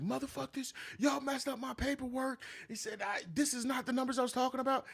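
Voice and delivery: high-pitched mock rant; mock yelling man. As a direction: squeal out his angry complaint. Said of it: "he was yelling it".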